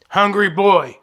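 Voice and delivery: booming playful shout; loud exclamation. Real, not acted. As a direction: belt it out proudly. Shouting his own nickname.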